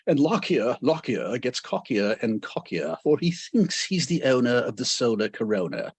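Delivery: hammed-up British accent, reciting a ditty; a deliberately terrible British accent for the rhyme; bad British accent. Shown as a demonstration. Lecturer recites Maxwell's ditty in a bad British accent. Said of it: "I have to do it in a bad British accent".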